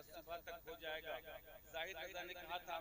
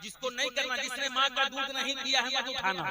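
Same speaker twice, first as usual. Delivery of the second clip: much louder, pitch climbing, full preaching force; fiery challenge; 5 semitones higher, 15 dB louder than usual. Real, not acted. Preacher loudly daring the crowd to raise their hands.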